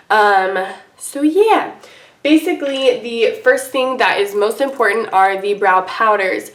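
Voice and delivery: normal talking voice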